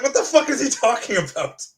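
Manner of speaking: loud, high incredulous outburst; exasperated retold "what on earth"; retold bafflement. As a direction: re-enact your disbelief loudly, starting high. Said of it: "That's what I said. I was like".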